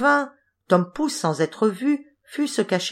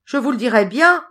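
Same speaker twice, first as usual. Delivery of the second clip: raised, higher storybook call; 6 semitones higher, 6 dB louder than usual. A narrator's reading. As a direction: pipe up loudly to the passers-by. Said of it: "leur cria Tom Pouce".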